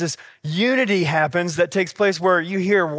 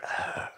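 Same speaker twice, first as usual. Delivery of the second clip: weary groan; 13 dB quieter than usual. Retold or imitated. Dad reacts to daughter.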